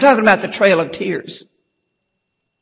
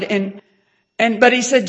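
ordinary talk

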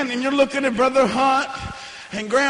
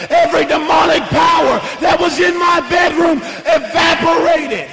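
loud, driving revival preaching; full-force preaching; 3 dB louder than usual. Acted. A preacher assuring the congregation God brings them out, loud and driving. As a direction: hammer each line home to the church.

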